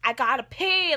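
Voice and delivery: a loud, desperate blurt; desperate yell. Retold or imitated. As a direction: shout it out, you can't hold it any longer. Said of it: "And I'm like".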